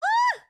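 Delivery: sudden high, loud "ah"; real high-pitched cry of surprise; startled yelp. Real, not acted. Streamer caught out by the game's sound, startled yelp.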